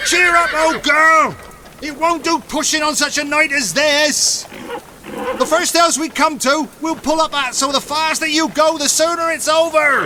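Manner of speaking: high, hearty cry of a traveller cheering on his tired mare; Tom Smart urging his horse. Acted. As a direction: bellow encouragement to the horse through the gale, high and jolly. Tom urges his horse in storm.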